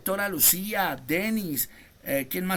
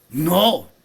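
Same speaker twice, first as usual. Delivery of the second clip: loud, firm no; emphatic no; 3 semitones lower, 8 dB louder than usual. Acted. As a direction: cut it off hard. Preacher rejecting doubtful testimony.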